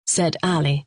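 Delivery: normal talking voice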